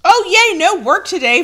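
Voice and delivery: high, loud happy cry; excited. Real, not acted. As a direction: squeal with delight. Happy about a chat message.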